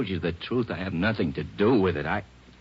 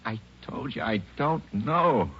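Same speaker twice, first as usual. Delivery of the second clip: desperate pleading; 6 semitones higher than usual. Acted. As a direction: voice breaking as he pleads. Suspect breaking down.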